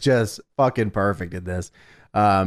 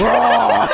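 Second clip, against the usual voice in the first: high, raised mock roar; imitated monster roar; 8 semitones higher, 4 dB louder than usual. Host imitating monster wrestler at camera, mock roar. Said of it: "look at the camera and go".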